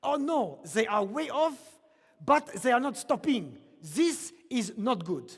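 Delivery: put-on alarmed Quebecois colleague backstage, in a Quebecois accent. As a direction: imitate a Montreal colleague's Quebecois French accent. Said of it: "in his thick Quebecois accent".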